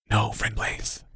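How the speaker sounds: very soft, secretive whisper. A narrator's reading. Cloaked figure answers the boy, hushed whisper. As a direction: whisper it right under the breath.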